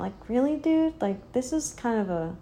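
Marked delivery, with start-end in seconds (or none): 0.3-1.0 incredulous. Acted. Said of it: "that's kind of my way of looking at it".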